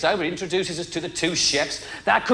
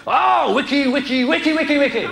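mock Chinese babble; 3 semitones higher than usual. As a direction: rattle off the one word over and over. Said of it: "He just went".

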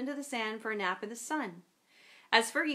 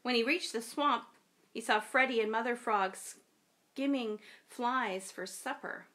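plain reading voice

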